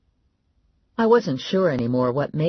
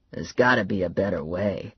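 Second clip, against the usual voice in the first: grumbling father; 7 semitones lower than usual. Acted. Father grumbling about women, low. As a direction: lower grumble.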